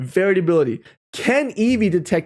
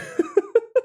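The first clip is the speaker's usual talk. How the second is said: squeaky giggle; a quick amused laugh; high laugh. Real, not acted. Laughs at own joke.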